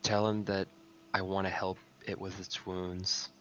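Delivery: soft hushed whisper. Acted. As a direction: lean in and whisper it. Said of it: "I wanna whisper to Aaron".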